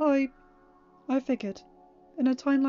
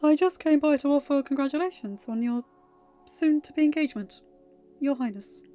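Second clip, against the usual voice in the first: loud, raised, formal congratulations; controlled, courtly well-wishing; hiding hurt; 5 semitones higher, 10 dB louder than usual. Acted. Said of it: "tried to say it without voicing the hurt".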